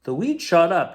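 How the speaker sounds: usual speaking voice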